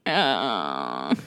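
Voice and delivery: long, low groan; disgusted groan. Real, not acted. Reacting to a villain excusing a massacre, low exasperated groan. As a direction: let out a drawn-out groan, pitch sinking.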